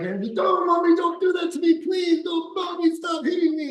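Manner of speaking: put-on high, shouted begging like a beaten kid; imitating the man next door begging mommy; mock pleading child. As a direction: plead and wail for mommy to stop. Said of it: "'cause he's like".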